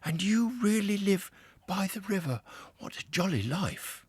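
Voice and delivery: a newcomer seeing the river for the first time; Mole, awed and a little breathless; wide-eyed Mole. A narrator's reading. Mole delighted.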